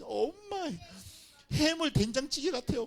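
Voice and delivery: 아이처럼 애교; sweet kid flattering. Retold or imitated. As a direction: sweet-talk mom like a child. Child flattering mom.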